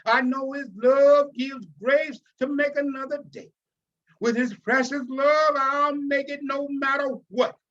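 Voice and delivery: preaching. Acted. Pastor preaching God's love to the room, fervent and loud. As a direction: preach it with full fervour to the congregation. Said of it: "He starts to preach".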